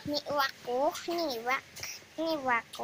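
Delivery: low, quiet puppet voice; goofy muffled sock puppet. Pretend. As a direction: talk low and soft like a hand puppet. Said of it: "pakai boneka tangan".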